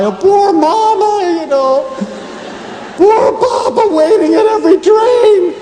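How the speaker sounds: high, loud mock wail of a tearful child; mock crying. Children weeping over Mama's train story, high mock wail. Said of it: "and we'd cry".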